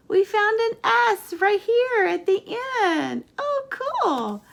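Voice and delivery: high, loud burst of delight; thrilled teacher, pitch soaring; excited discovery. Real, not acted. Teacher celebrates finding an S.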